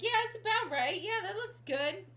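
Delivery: high, loud mock approval; hammed-up high-pitched okay. Acting out an offhand size check.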